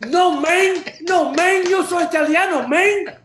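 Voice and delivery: loud, high playful Tony Montana voice; hamming up a Miami Cuban accent; mock Cuban accent. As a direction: Cuban gangster accent, played for laughs. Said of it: "I start talking like Tony Montana".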